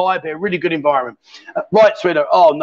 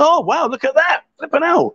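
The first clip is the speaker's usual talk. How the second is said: higher-pitched burst of astonishment; amazed reaction; 8 semitones higher than usual. Real, not acted. Host reacting to the woman's tattoo, amazed. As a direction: exclaim it, pitch jumping up. Sees her tattoo on stream.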